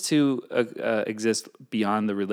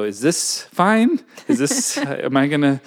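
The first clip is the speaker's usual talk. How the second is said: uneasy questioning; 6 semitones higher, 6 dB louder than usual. Acted. Doubting a new relationship aloud, hammed-up unease. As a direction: play up the uncertainty, half laughing.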